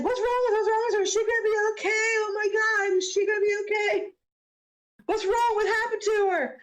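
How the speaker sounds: high-pitched panicked character voice; freaking out. Acted. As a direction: fire out frantic, worried questions. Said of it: "freaking out".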